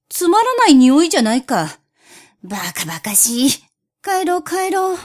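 sulky cat muttering then huffing off; grumbling cat voice. Acted.

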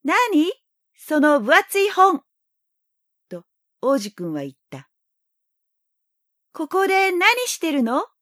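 put-on high, curious little boy; young boy voice. A narrator's reading. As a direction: ask eagerly like a child.

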